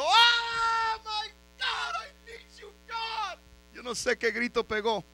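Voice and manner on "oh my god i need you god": very high, drawn-out cry; hammed-up scream, stretched out high; acted scream for help. Surrounded king crying out to God for rescue, acted scream. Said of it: "gritó".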